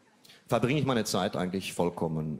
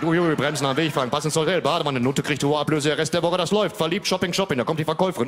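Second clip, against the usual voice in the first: higher, louder breathless play-by-play; football commentary; 7 semitones higher, 5 dB louder than usual. Pretend. Retelling Pretty Woman as a loud, higher live football commentary. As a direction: call the film like a live match.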